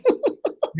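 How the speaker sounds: short high laugh. Real, not acted. Laughing after punchline.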